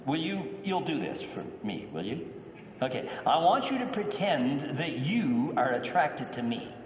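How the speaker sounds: normal talking voice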